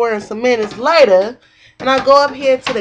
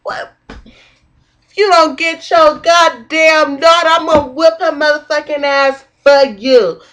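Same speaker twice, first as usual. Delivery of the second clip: retold yelled threat; 5 semitones higher, 6 dB louder, slower than usual. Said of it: "I'm like".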